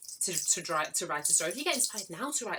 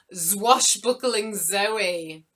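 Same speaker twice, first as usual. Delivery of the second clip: loud, slow, relished pirate name-call; 3 semitones higher, 9 dB louder, much slower than usual. Pretend. Host gives Zoe a pirate name, loud playful pirate. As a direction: stretch it out like a swaggering mock pirate.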